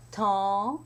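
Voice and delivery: temps with the vowel held long; longer vowel. Shown as a demonstration. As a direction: hold the vowel of temps at the pause. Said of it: "longer vowel".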